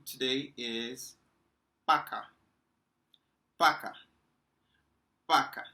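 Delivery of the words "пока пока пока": пока said as pa-ka, much louder and short; Russian pronunciation. Shown as a demonstration. Teacher shouting пока to the class as a goodbye, loud pron. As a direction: call out пока loudly like a goodbye. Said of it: "As you can tell from my, uh, expression".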